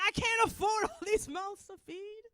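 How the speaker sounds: high, loud mock exasperation; exasperated complaint. Owner fretting over mouths to feed, hammed-up exasperation. Acted complaint in cat story.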